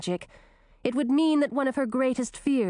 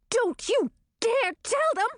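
high indignant cry, far higher. A narrator's reading. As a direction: cry it out in protest. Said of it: "cried Chartreuse".

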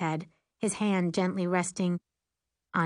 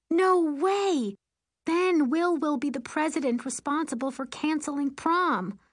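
high-pitched emphatic objection; urgent, high-voiced refusal; alarmed protest; 8 semitones higher than usual. A narrator's reading. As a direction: burst out against the idea, pitched up high. Friend protests cancelling the prom.

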